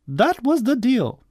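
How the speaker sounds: high-pitched scolding mother; storybook cross mother; really cross. A narrator's reading. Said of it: "she was really cross".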